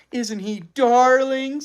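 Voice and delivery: put-on high, slow girlish coo; gushing girl. A narrator's reading. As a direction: coo over a cute puppy.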